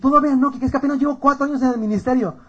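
high-pitched mock excuse; defensive excuse. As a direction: imitate someone cornered, pleading for time. Imita la excusa del interpelado. (excusa aguda a la defensiva)

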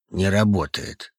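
slow, low, musing; задумчиво. A narrator's reading. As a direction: weigh it slowly, half to yourself. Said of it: "сказал он задумчиво". (задумчиво, медленно)

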